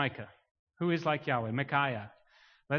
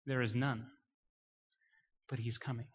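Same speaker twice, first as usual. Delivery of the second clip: low, soft, subdued delivery; hushed preaching; 4 semitones lower, 6 dB quieter than usual. Real, not acted. Preacher giving the message of the prophets, hushed. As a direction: let it sink almost to a hush. Hushed take before the loud repeat.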